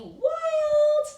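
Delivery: very high, loud, drawn-out "wild"; wild playful call. Shown as a demonstration. Teacher leading a phonics drill, wild playful call. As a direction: let out a high, stretched whoop on the word.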